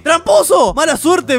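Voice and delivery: loud high jeer, calling the player a cheat; taunting shout. Acted. Fair host yelling a taunt at the player. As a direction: yell it out like a gloating cheat. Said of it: "Me dice".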